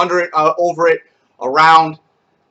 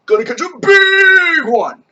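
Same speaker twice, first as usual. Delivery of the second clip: high loud stretch on big; big emphatic; 13 semitones higher, 6 dB louder than usual. Shown as a demonstration. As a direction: make big one huge and high. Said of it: "You wanna make a big thing".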